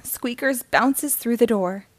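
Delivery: ordinary delivery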